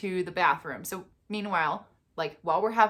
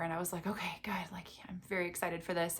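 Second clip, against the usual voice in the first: whispered, softer; 3 semitones lower, 11 dB quieter, faster than usual. Real, not acted. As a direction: hush it like a secret. Quiet moment before ultrasound.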